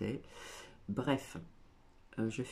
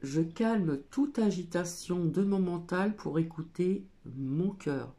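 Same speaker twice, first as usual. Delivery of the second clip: card reading; 4 semitones higher, 6 dB louder than usual. Acted. Reads the card text aloud.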